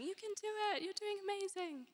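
high, cheery encouragement; cheering crowd. Pretend. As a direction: cheer them on from heaven. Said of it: "just going".

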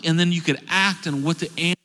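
ordinary delivery

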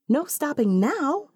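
high, eager outburst; exclaiming. A narrator's reading. Naomi prodding a friend out of sudden silence, exclaiming. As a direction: pitch jumps up in an eager exclamation. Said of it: "she exclaims".

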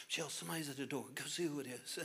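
soft, whispered nighttime question; husband whispering to wife at night, softly; hushed whisper. Retold or imitated. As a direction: whisper to your wife in bed. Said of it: "husband whispering to wife".

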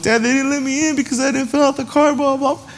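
high, put-on little-kid voice; whining child. Kid explaining to dad why he was shut out, whining. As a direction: imitate a child pouring out excuses in one high run.

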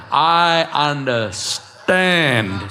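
UNDERSTAND split as UNDER, then a long STAND; very slow, drawn-out UNDERSTAND; emphatic word split. Acted. Pastor answering the objector, emphatically drawn out.

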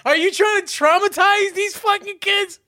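loud, high-pitched incredulous scolding; acted outrage. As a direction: tear into a partner, hammed up for laughs. Parent objects to partner's film choice.

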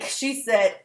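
usual speaking voice

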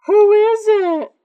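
put-on high, loud, slow girl's voice calling out; girl in the background. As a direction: call out like a girl far off in the room. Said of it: "there's a girl in the background".

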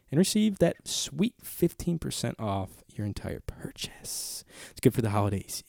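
ad whisper. Acted. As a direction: whisper the deal like a secret. Ad read with whispered promo.

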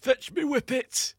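high, slow put-on Yorkshire voice; lightly hammed Yorkshire; mock Yorkshire hunter. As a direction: play a broad Yorkshire rabbiter calling for his dog. Mocking Doncaster hunter.

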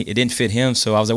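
usual speaking voice

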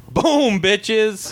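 high-pitched mock victory cry; triumphant crow. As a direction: crow it like a cocky winner. Crowing punchline after walkout story.

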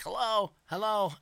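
high, loud hello through the door; calling out hello at the door. Retold or imitated. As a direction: call into a room to check. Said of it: "I'm like".

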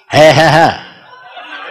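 drawn-out happy laugh, each ha separate; slow joyful laugh. Real, not acted. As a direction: let a slow glad laugh roll out. Joyful laugh after Pentecost story.

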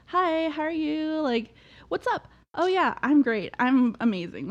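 high cheerful phone voice; everything-is-great act for mom and dad; bubbly voice for parents. Retold or imitated. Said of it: "You talk so different to them".

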